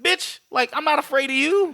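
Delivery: high-pitched mock defiance; defiant challenge. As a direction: act out standing up to a bully, pitched high. Acted defiant confrontation in retelling.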